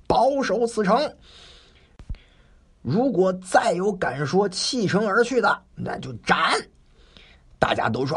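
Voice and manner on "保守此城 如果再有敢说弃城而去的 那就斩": high, stern command to hold the city; rally the garrison with a stern order. Acted. 守将对部下下令，严厉高声. Cao Ren rallying defenders.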